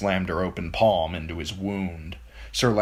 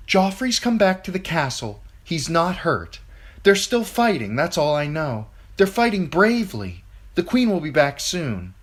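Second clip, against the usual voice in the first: high, louder soothing lie; Sansa soothing; 10 semitones higher, 4 dB louder than usual. A narrator's reading. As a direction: reassure them firmly over the noise.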